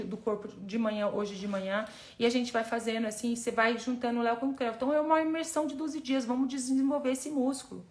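ordinary talk

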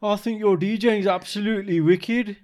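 high, slow drawn-out Brummie; Birmingham accent. Shown as a demonstration. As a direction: exaggerate a singsong Birmingham accent, stretched out. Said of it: "Right, Birmingham".

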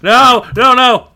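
loud panicked no, no, no; desperate shout. Acted. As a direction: cry out in horror. Jake panicking, voiced loud.

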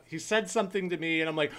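normal talking voice

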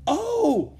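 high surprised cry; cried out. Acted. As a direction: gasp out in sudden joy. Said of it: "Rose cried out".

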